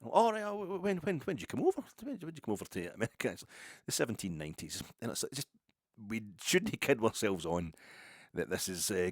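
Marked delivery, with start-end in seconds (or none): 0.0-3.3 much higher mock American tourist voice; chatty American lady. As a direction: squeak it up like an eager old tourist asking questions. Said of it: "he's Scottish as well".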